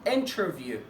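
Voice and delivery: "انترفيو" with the T pronounced; a clear light T in "انترفيو"; standard English. Shown as a demonstration. Teacher giving the standard form, T sounded. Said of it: "في الستاندرد انجلش بتبقى اسمها".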